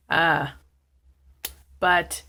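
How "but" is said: ordinary talk, nothing marked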